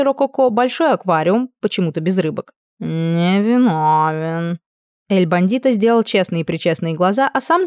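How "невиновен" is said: very slow innocent cat drawl; snooty bored cat. Acted. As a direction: feign innocence, stretched out.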